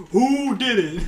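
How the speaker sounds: high, loud call-out; mock demanding yell. He wants to know who did it, mock demanding yell. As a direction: imitate someone loudly demanding the culprit.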